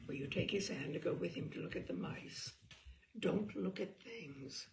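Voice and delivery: usual speaking voice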